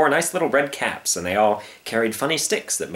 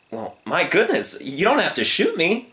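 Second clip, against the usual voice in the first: higher, pleading protest; innocent young lion; 8 semitones higher than usual. Acted. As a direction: reason sweetly with the hunter. Young lion reasons with hunter.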